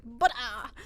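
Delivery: punchy pretend tantrum word; imitated toddler anger. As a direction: burst out like a cross toddler. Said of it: "for when she was angry".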